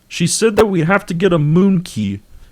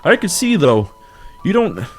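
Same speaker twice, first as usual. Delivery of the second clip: Kermit; 3 semitones higher than usual. Acted. Game character urging Howard to flee, Kermit impression. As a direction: plead in a singsong puppet-frog voice. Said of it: "get back to our regularly scheduled Kermit voice".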